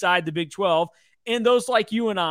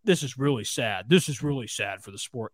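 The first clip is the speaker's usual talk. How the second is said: mock sadness; 5 semitones lower, 4 dB quieter than usual. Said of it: "they ultimately end it by".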